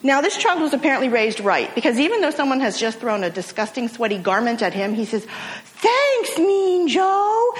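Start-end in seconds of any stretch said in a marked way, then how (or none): 5.6-7.5 put-on much higher, thrilled child's thank-you; excited little boy. Boy thanks the football star for the jersey, high thrilled child voice. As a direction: sound like the kid who just got the jersey. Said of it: "And he's really excited".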